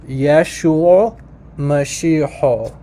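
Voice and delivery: very slow "Yeshua Mashiach", ending in "ho"; Western Syriac pronunciation. Shown as a demonstration. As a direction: stretch out "Mashiach" as "ma-shee-ho". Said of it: "how they pronounce the name Jesus".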